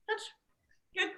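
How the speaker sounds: high, soft squeaky dog praise; squeaky praising a dog. Real, not acted. Trainer praising a tired dog.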